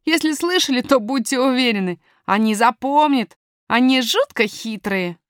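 put-on confident young miner talking to strangers; boy miner, self-assured.

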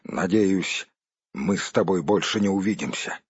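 low, gloomy dismissal; sullen. A narrator's reading. Dwarf hopes never to see the hobbit, sullen. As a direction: dismiss him coldly, pitched low. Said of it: "угрюмо отозвался Торин".

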